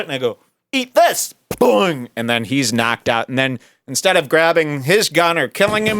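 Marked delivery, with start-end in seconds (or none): 0.7-1.3 much higher action-hero taunt; high-pitched attack line; mock battle cry. Acted shovel attack. 1.5-2.1 high springy "boing"; comic sound effect; cartoon impact noise. Imitates shovel hitting head.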